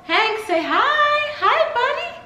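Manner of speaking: high, slow sing-song coo to the puppy; cooing at the puppy, laughing. Real, not acted. Baby talk to the dog.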